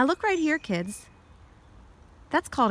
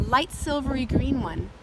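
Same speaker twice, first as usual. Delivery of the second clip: ordinary delivery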